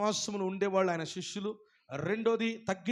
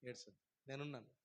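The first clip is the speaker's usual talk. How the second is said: much softer, lower comfort; tender cooing; 7 semitones lower, 15 dB quieter than usual. Retold or imitated. God consoling a crying believer, soft cooing. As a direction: coo gently to a crying darling. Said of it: "ఆయన ఏమంటాడు".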